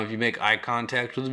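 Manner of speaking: ordinary delivery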